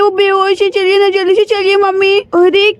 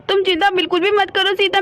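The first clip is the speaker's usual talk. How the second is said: ordinary talk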